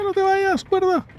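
very high pleading squeal; pleading squeak. Real, not acted. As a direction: beg the rope in a squeak. Pleading with game object.

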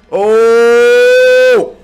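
sudden loud shocked shout; startled yell. Real, not acted. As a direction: jump scare yell. Giant enemy suddenly appears.

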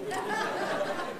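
short high laugh breaking out. Real, not acted. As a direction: burst into a quick amused laugh. Laughs at own realisation.

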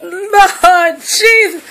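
slow laughing wail; laughing cry. Real, not acted. Owner laughing over the dog's mess.

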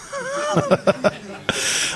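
real falsetto giggle; a long high laugh; high squealing laugh. Real, not acted. Comedian laughing at the man with two dogs, high squeal.

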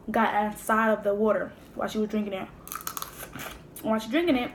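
chewing while talking; eating crab while speaking; mouth full. Real, not acted. Seen 2: Picks crab meat into mouth, chews; mouth seems full till ~4.8.

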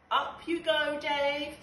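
loud, high urging; encouraging call. Acted. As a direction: cheer him up the tower. Bug urging Dave up.

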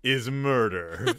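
slow, dramatic trailer voice; movie trailer voice. Pretend. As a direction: sell it like a horror tagline. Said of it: "would be a great tagline".

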